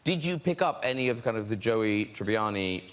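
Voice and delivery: normal talking voice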